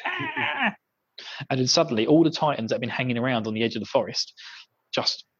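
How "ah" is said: piercing high monster wail; high shrill wail. Retold or imitated. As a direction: let out a cornered creature's scream. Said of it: "wail of a scream".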